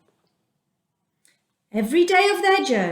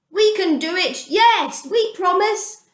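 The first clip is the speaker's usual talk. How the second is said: high, keen promise, rising to a louder yes; eager promise; 8 semitones higher, 3 dB louder than usual. Acted. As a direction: promise it all at once, eager to please. The people eagerly promise God.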